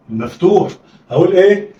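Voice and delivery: usual speaking voice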